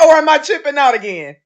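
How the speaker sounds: normal talking voice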